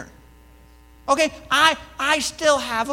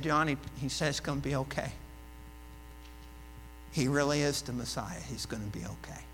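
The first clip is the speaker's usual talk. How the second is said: comforting; 9 semitones lower, 13 dB quieter, faster than usual. Acted. Comforting John in prison.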